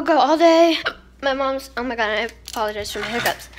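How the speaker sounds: ordinary delivery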